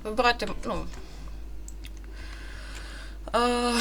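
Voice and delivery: ordinary delivery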